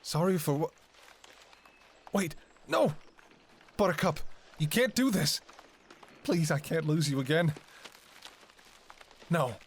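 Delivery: rising, frantic plea; desperate pleading. Acted. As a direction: clutch at him before he goes. Fiancé is leaving her.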